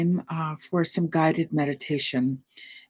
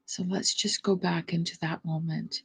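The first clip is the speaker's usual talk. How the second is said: guided meditation; 3 dB quieter than usual. Acted. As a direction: ease the listeners into stillness, unhurried.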